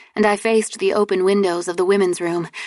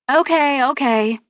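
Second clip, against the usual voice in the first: raised voice calling the group; loud; 7 semitones higher than usual. Acted. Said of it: "I said loudly".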